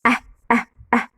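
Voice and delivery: "ah" as a short open è, like English "eh"; three separate open-mouthed è sounds for "ah, ah, ah". Shown as a demonstration. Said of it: "On ouvre la bouche".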